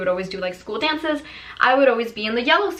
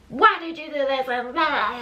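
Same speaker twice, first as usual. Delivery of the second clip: imitating a flipping out dad; 5 semitones higher than usual. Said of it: "flipping out".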